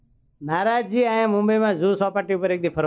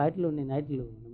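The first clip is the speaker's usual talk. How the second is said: આટલું ને આટલું dropped much softer, like a greedy murmur; soft mock greed; 9 dB quieter than usual. Shown as a demonstration. Preacher mimics greedy people wanting more, softly. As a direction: let આટલું ને આટલું fade into a hushed grasping echo. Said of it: "ભગવાન જેટલું આપે તો હજી આટલું".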